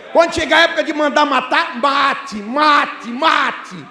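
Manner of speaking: rally the flock with fire; fiery preaching. Acted. Pastor exhorting the congregation not to fear prison, fiery shouting.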